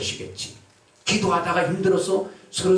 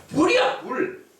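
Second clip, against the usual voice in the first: shouting fire; 13 semitones higher, slower than usual. Acted. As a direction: yell fire in panic. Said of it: "소리 지르는 거야".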